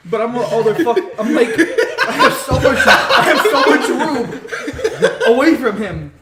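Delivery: loud, high protest through laughter; laughing. Real, not acted. Telling friends how much room he had, laughing loudly. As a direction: shout it while cracking up. Storyteller cracks up while protesting.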